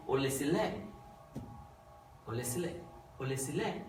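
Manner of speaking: "Ó lé sílẹ̀" with rising English stress on "sílẹ̀", a mock American reading; American accent. Shown as a demonstration. Teacher showing the wrong Western accent. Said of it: "if it sounds American".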